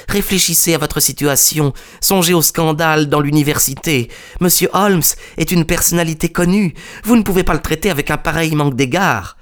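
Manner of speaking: high-pitched, urgent appeal to the professor; pleading collaborator. A narrator's reading. (collaborateur suppliant, voix haut perchée)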